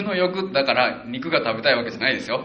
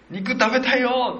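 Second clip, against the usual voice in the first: higher, slow, whining mock cry; whiny wail; 11 semitones higher, 4 dB louder, slower than usual. Israelites wailing to Moses for meat, high whiny mock cry. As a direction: whine for meat like a spoiled child. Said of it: "大声で泣いて、うわ、言ったんですよ".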